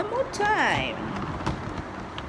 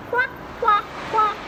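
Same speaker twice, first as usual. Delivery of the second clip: duck quacking; 11 semitones higher than usual. Acted.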